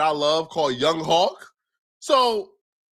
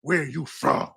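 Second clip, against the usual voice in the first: mock gang challenge; 6 semitones lower, slower than usual. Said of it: "to ask a ninja".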